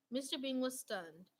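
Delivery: ordinary delivery, nothing marked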